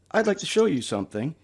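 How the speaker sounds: ordinary talk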